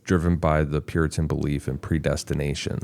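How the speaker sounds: normal talking voice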